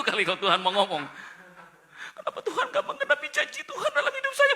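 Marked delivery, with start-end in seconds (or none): 2.2-4.5 mock wail. Mock lament to God.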